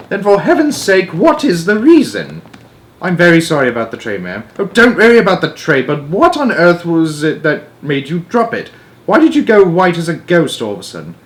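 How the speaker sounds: a queen demanding answers; impatient Queen. Acted. Queen presses Mary to explain, high impatient voice.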